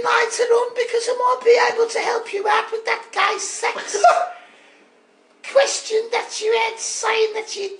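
very high, comic Brummie caller; Dave from Birmingham. Acted. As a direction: pitch it way up, cheeky Birmingham accent. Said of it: "it's me accent".

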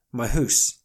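Canadian "my hoose", vowel stretched to oo; Canadian accent. Retold or imitated. Teasing how Canadians say house. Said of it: "they will say".

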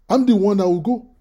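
ordinary delivery

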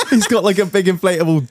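talking through laughter; laughing. Real, not acted. Laughing about the duck.

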